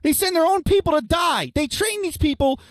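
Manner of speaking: heated rant. Real, not acted. Ranting about barbarians.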